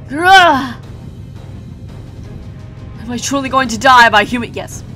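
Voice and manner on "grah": loud guttural roar of pain. Acted. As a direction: roar as if struck. Giant struck in battle.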